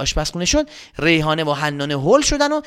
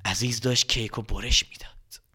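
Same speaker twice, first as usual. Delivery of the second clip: hushed whisper; 6 semitones lower, 6 dB quieter than usual. A narrator's reading. Narrator whispering as grandma cuts the cake. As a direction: whisper the suspense.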